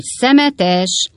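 loud, high heckle; a heckler calling out; shouted insult. A narrator's reading. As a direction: yell it from the crowd. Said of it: "elkiáltotta magát".

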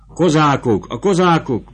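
high, loud alarm cry; panicked shouting. Acted. Fleeing soldiers shout the alarm, high and loud. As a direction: yell the warning in panic. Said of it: "ordítoztak mindenfelől".

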